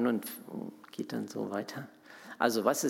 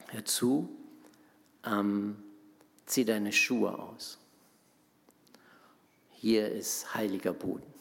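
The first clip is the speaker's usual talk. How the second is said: voice of God. Acted. God speaks to Moses at the bush.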